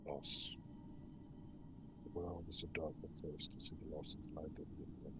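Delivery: low, soft, breathy ghostly lament; a lost spirit grieving over rubble, quietly; mournful ghost. Acted. Ghost laments the fall of Ythryn, low and whispery.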